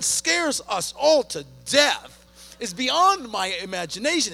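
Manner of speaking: loud, driving preacher delivery, hammering each line; preaching at full force. Acted. Pastor preaches loudly to the congregation. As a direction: pastor builds to a loud preach about his teenage daughter.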